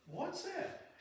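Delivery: very high squeaky chicken voice; curious chicken. Retold or imitated. Preacher voicing another chicken, squeaky and high. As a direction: pipe it like a curious hen. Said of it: "another chicken looks and says".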